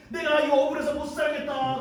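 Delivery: high, hammed-up cry of injustice; hysterical lament. Wife playing victim to the household, mock hysterical lament. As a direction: make a big fake fuss. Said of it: "난리를 치니까".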